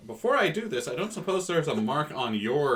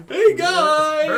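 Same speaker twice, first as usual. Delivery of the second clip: loud, high mock cheerful shout; imitated yell; 12 dB louder than usual. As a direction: throw out a big bright "hey guys". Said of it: "gravelly voice to just like".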